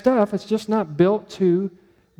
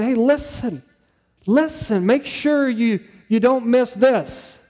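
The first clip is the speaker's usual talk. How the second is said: higher-pitched, insistent appeal; urgent attention call; 5 semitones higher, slower than usual. Retold or imitated. Preacher voicing God's call to distracted hearers, urgent and insistent. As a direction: grab the distracted listeners and press the point. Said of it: "Their attention".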